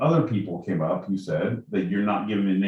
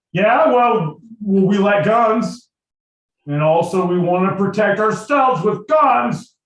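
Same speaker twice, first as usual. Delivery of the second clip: high, loud comic retort; loud, drawn-out mock defiance; mock defiant shout; 10 semitones higher, 7 dB louder, slower than usual. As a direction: shout back like a hammed-up gun lover. Acting Henry and Mason defying Madison.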